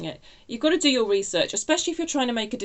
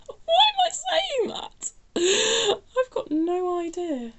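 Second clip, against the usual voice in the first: high, laughing-crying disbelief; laughing helplessly; 13 semitones higher, 4 dB louder, much slower than usual. Real, not acted. Reader cracking up at her own channelled words, laughing.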